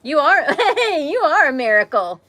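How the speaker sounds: high-pitched, laughing praise at full volume; excited affirmation. Real, not acted. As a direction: cheer them on with delighted laughter. Gushing to child who interrupts.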